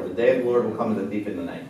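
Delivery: rattled off fast like a well-known saying; tossed off quickly, as everyone knows it; quick familiar recital. Shown as a demonstration. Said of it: "How many of you heard that before".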